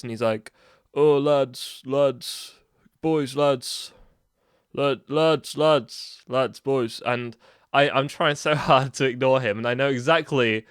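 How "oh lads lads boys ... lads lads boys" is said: lightly voiced rough bloke calling out, lads; a hint of a chummy stranger calling out; put-on matey voice. Rough stranger calls after the lads, mild put-on matey voice. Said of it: "he automatically changes his voice".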